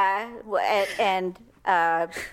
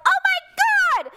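high, loud, slow horrified wail; horrified cry; 5 dB louder, slower than usual. Retold or imitated. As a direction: wail it as the danger sinks in. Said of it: "I'm like".

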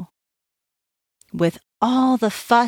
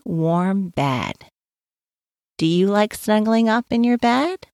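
usual speaking voice

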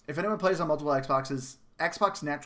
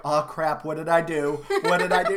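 mock panic; 2 semitones higher, 7 dB louder than usual. Mock panic looking around.

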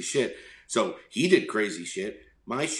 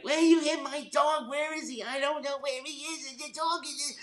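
much higher, shrill mock of an upset dog owner; yelling lady. Dog owner yelling at the driver who hit her dog, high mock squeal. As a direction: squeal it like the frantic neighbor lady. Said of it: "she starts yelling at me like".

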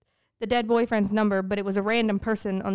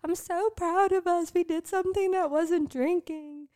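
mock proud voice; 8 semitones higher than usual. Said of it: "we were like".

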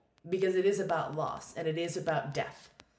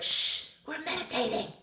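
put-on high, bossy child hushing the room; bossy girl; 9 semitones higher, 3 dB louder than usual. Bossy girl shushing other kids in the temple, imitated. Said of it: "the bossy girl's like".

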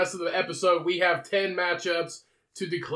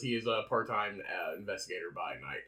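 ordinary talk

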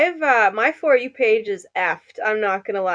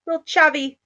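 put-on chavvy British accent; 6 semitones higher, 3 dB louder than usual. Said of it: "You're going a little chavvy".

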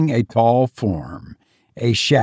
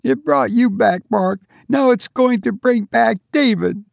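high, insistent woman's voice; desperate wife; 10 semitones higher than usual. A narrator's reading. Wife clutching bell before husband, desperately insistent.

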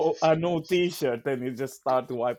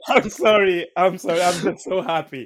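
apologizing through helpless giggles; loud, laughing, can't stop; giddy laughter; 3 semitones higher, 7 dB louder than usual. Real, not acted. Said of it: "I don't know why I can't stop laughing".

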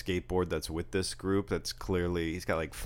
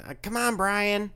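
high, raised mock holler; mock yell; 5 dB louder than usual. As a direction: imitate kids hollering at a friend, high-pitched. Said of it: "they're yelling".

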